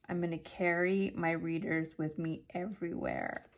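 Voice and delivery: ordinary delivery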